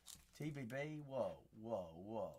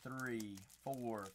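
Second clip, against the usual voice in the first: normal talking voice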